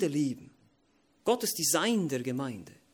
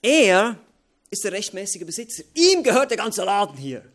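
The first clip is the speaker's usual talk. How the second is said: forceful preaching; 5 semitones higher, 10 dB louder than usual. Acted. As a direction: hammer each phrase home to the congregation.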